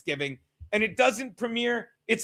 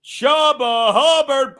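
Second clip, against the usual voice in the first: announcer call; 3 semitones higher, 8 dB louder, much slower than usual. Shown as a demonstration. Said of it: "it's just fun to say".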